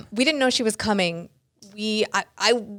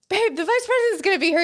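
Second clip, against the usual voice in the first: much higher, breathless news to husband; excited alert; 12 semitones higher than usual. Retold or imitated. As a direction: burst in with urgent news. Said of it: "And then I was like".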